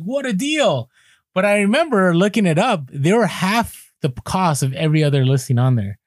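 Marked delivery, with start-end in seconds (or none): 0.0-0.9 mock delight. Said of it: "I'm like".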